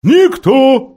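high, loud owl shout. A narrator's reading. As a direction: yell it at a deaf ear. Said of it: "прокричала сова".

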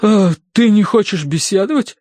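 high, flustered question; растерянность. A narrator's reading. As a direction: falter in confusion as the hostess. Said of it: "растерялась миссис Меринг". (растерянно, высоким голосом)

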